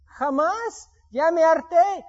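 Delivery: high, loud, exasperated outburst; fed up. Retold or imitated. Telling the church he gave up on bricklayers, exasperated and loud. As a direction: snap that you've had enough. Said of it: "Yo dije".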